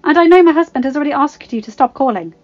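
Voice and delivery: frightened but insistent; nervous protest. A narrator's reading. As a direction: push through nerves to object. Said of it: "hating the way her voice wavered".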